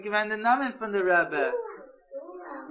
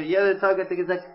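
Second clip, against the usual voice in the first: plain reading voice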